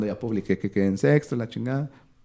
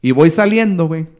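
normal talking voice

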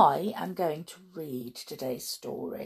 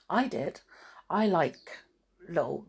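usual speaking voice

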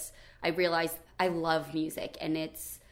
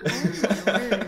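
ordinary delivery

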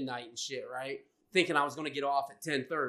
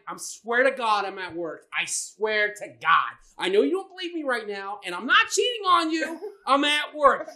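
higher, louder desperate plea; pleading; 6 semitones higher, 7 dB louder than usual. Retold or imitated. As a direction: beg her to believe you. Pleading with girlfriend on phone.

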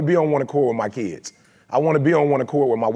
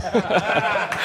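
laugh; 2 semitones higher than usual. Real, not acted. Laughing at his own story.

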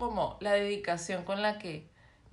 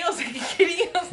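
mocking incredulous voice; 9 semitones higher than usual. Mocking husband's reaction.

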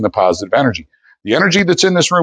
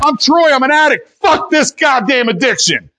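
defiant shout. Real, not acted. Shouting his recovery declaration.

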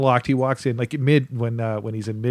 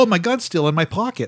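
mock flustered; 7 semitones higher than usual. Acting out the fumbling brother.

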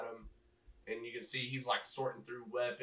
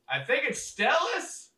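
much higher, louder voice, a bit slow; other soldier; 14 semitones higher, 10 dB louder than usual. Acted. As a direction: answer unsure in a high voice. Other guy answers.

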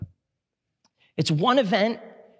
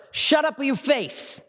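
higher, blunt rebuke; Jesus's blunt rebuke; 7 semitones higher, slower than usual. Acted. Preacher voicing Jesus silencing the demon, a blunt higher rebuke. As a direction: snap it like a scolding. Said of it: "That's Hebrew for".

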